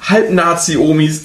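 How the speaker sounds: ordinary delivery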